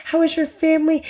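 high, sugary fake-friendly chatter; fake nice voice. Mocking a fake-nice neighbor, high and sugary. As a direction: gush like a two-faced neighbor. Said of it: "when someone is so fake".

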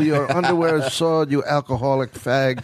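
high, haughty, pompous insult voice; Lovitz impression. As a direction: sneer it like a pompous snob. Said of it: "he goes".

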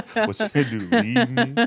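high, laughing repetition. Retold or imitated. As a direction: giggle through the words. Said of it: "repeats friend's line laughing".

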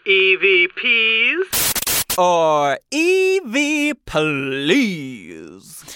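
slow eerie ghostly announcer voice; spooky announcer. Pretend. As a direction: drag it out like a haunted host.

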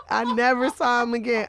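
normal talking voice

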